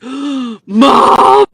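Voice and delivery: loud, high alarmed cry of mom; alarmed daughter. Retold or imitated. Daughter realising the AI connection, loud alarmed cry. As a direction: shout it in sudden realisation. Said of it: "She's like, gasps".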